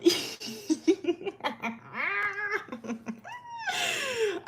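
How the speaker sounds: long, high wheezing laughing fit; helpless laughter. Real, not acted. Storyteller losing it mid-story, helpless high laughter. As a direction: laugh till it turns squeaky. Cracks up at chat.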